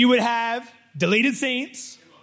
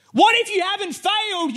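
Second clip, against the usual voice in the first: higher, probing question; raised questioning; 13 semitones higher, 4 dB louder than usual. Acted. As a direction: lift it to make them wonder. Preacher poses key question.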